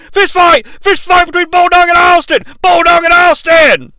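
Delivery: high, loud play-by-play call; hammed-up announcer shout of a brawl; mock commentator shout. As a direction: yell it like a ringside announcer losing it.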